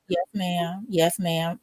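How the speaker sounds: low, soft sulky compliance; sulky pout. Retold or imitated. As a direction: answer mother with your lip poked out. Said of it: "I got my lip poked out".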